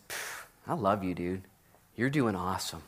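soft, low, gentle reassurance; tender comfort. Acted. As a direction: comfort a battered friend quietly. Jesus encourages Paul.

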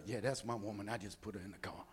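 low, hushed, self-satisfied cool; suave swagger. Retold or imitated. Man showing off after seating his woman in the car, suave. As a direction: keep it low and smooth, strutting with pride. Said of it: "he's got his little suave going on".

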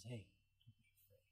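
gentle reassurance. Retold or imitated. Jesus comforting John.

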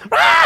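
high shouted shriek; hammed-up shout. Disciples panicking at Jesus, a hammed-up shriek. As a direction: yell it like you've seen a ghost.

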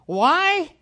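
ordinary talk

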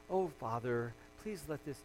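low, soft imitation of pious praying; mock serene prayer. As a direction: pray gently and sedately, letting it fade. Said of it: "I don't think that's how Jesus was praying".

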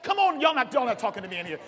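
ordinary delivery

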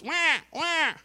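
short high "wah" wails; baby's wail, imitated; imitated baby cry. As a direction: mimic a crying infant. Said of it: "Infants, all they do is".

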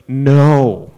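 put-on loud, stubborn child's no; kid's loud refusal. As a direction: yell no like a scared kid. Kid refusing the tweezers.

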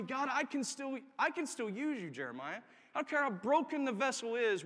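high, urgent preacher's appeal; fervent preaching. Real, not acted. Preacher urging the church to be sold out, fervent appeal.

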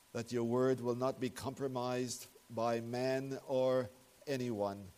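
ordinary talk

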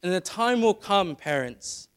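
impassioned pulpit voice; preaching at full force. Acted. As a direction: press the call home to the congregation. Preaching warning to parents.

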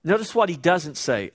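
normal talking voice

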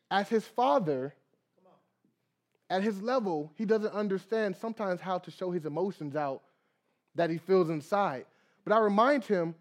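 usual speaking voice

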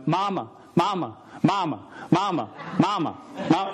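put-on a kid whining for mom from the back seat; calling mama. Preacher imitating his daughter calling mama from the back seat.

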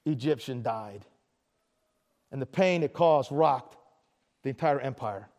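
somber narration. A narrator's reading. Grave narration of deaths.

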